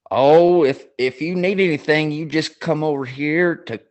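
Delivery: retelling the sketch with a hint of a Southern colonel; Southern accent. Telling a friend about the sketch, lightly imitating a Southern accent. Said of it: "he's got that Southern accent".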